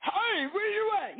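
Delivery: high-pitched mock holler; acted crazy screaming. Lost spouse who can't hear him, acted screaming. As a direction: holler for someone out of earshot. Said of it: "y'all screaming like y'all crazy".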